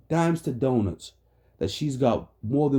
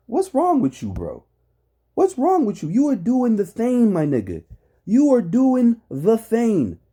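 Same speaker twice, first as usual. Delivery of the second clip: exasperated yelling; 7 semitones higher, 5 dB louder than usual. Real, not acted. Heated rant at co-host.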